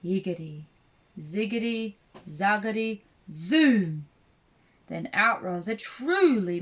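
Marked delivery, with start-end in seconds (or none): 0.0-4.1 measured incantation building to a burst; storybook witch casting a spell; muttered spell. A narrator's reading. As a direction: chant the magic words one by one, landing hard on the last. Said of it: "she muttered a spell".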